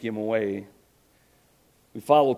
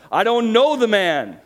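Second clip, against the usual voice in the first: much higher, louder, slowed furious oath; cursing denial; 10 semitones higher, 9 dB louder than usual. Acted. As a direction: spit the curse out. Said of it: "began to call down curses".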